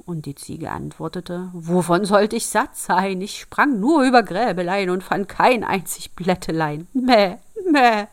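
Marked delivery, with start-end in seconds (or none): 1.5-8.1 loud, protesting goat voice; goat's indignant singsong complaint; bleating goat. Acted.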